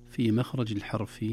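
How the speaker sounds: ordinary talk, nothing marked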